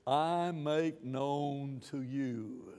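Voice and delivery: very slow, soft scripture reading; slow quiet reading. Retold or imitated. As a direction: read "I make known to you" slowly and softly. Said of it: "the New King James says".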